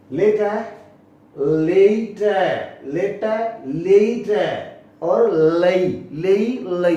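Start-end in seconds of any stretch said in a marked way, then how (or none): 1.4-5.3 लेट stretched slowly and louder, as "layt"; slow careful late. Shown as a demonstration. Teacher drilling students, slow loud लेट. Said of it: "तीनों में अंतर हो गया".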